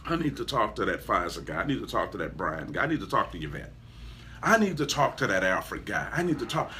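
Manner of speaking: hushed, earnest wanting to meet the hero; admiring seeker. Retold or imitated. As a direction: ask urgently but low, like a confidence. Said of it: "look at you and say".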